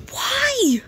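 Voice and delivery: high, loud, outraged why; shocked why. Real, not acted. Player reacting to her sim's bad luck, shocked and high. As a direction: cry out in disbelief.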